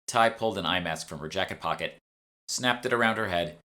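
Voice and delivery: ordinary delivery, nothing marked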